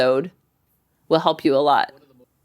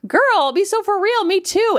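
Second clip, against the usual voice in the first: excited reassurance; 11 semitones higher, slower than usual. Retold or imitated. Host answers confessing listener.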